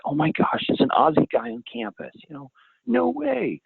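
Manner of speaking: put-on high, squealing college-girl voice; excited college girls. College girls swooning over the Aussie guy, high and gushing. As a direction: gush like a starstruck girl meeting an Aussie. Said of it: "They're like".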